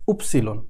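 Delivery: উপসিলন said low and soft, falling at the end; উপসিলন spoken quietly and clearly; German Y sound. Shown as a demonstration. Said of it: "এরপরে Y".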